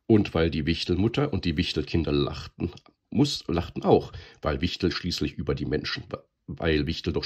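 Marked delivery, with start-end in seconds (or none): none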